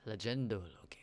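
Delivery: soft reverent whisper. Acted. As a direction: breathe it like a legend.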